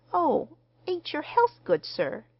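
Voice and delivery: worried little dressmaker; deep concern. A narrator's reading. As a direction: ask anxiously after a guest's health. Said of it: "face wore the deepest concern".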